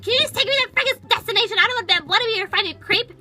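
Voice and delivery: angry at the Uber driver; angry demand. Retold or imitated. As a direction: snap at the driver. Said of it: "But no, not me".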